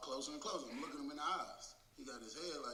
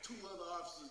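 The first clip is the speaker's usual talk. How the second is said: ordinary delivery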